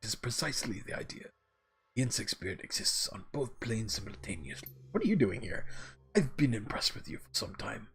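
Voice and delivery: lightly voiced mentor, measured and uneven; wandering character voice. Algernon explains insect spirits to the orc, in a lightly voiced, wandering character voice. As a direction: explain like a learned character, the voice drifting slightly. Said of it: "My voice for him is just going all the way around".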